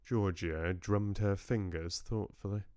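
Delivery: ordinary delivery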